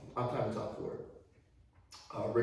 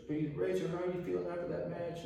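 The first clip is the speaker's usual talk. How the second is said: a lightly voiced reporter, pitched up; mock TV interviewer, higher; 7 semitones higher than usual. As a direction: ask it like a chirpy ringside interviewer. Interviewer quoted.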